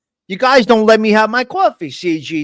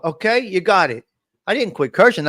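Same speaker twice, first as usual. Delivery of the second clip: usual speaking voice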